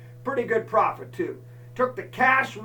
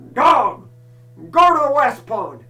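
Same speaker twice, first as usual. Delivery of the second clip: loud, high call to the dog; commanding shout; 5 semitones higher, 11 dB louder than usual. Retold or imitated. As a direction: order the dog off across the fields.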